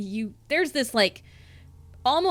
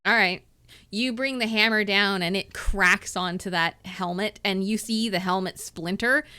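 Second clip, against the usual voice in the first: ordinary talk, nothing marked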